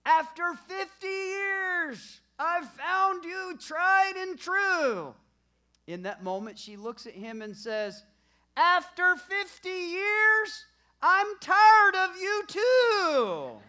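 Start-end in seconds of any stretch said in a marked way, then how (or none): 0.0-5.1 high, slow, loud shouted toast; husband shouting slowly and high. Retold or imitated. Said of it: "he says very loudly". 8.6-13.6 very loud, high, slow retort; punchline shouted high and slow; wife shouting back. Retold or imitated.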